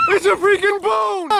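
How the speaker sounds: high laughing yell; excited laughing shout. Real, not acted. Dad sees the fish.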